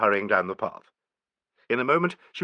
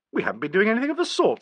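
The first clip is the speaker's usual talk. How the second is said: fast cross denial; 11 semitones higher, faster than usual. A narrator's reading. Nigel denying to Angela, cross. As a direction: snap back crossly. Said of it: "said Nigel crossly".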